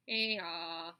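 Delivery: low, loud bleat; sheep bleating. Acted. The sheep answer the roll together, bleating. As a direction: baa like a flock. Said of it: "answered the sheep all together".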